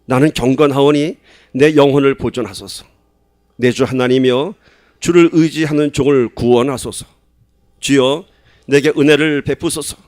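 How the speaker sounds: loud, measured psalm reading in unison; solemn, full-voiced reading of the psalm; scripture reading. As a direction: read the prayer out loud like a plea to God. Congregation reads psalm aloud.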